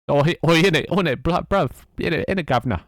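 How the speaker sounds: loud, high, exaggerated Cockney caricature; mock Cockney. Streamer imitating an acquaintance who went Cockney, mock Cockney accent. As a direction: put on a badly faked Cockney. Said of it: "he just went all, like, Cockney as fuck".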